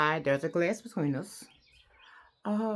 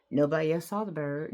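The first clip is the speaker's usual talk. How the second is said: ordinary talk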